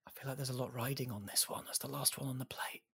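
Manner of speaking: hushed whisper, barely voiced. Real, not acted. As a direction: whisper it like a secret. Hushed tension before last cookie.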